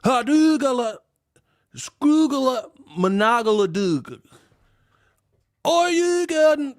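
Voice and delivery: high shouted gibberish like a fighting game move; Street Fighter Baltimore voice. Retold or imitated. Baltimore accent compared to Street Fighter, high fighting game cries. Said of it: "Baltimore sound like Street Fighter sounds".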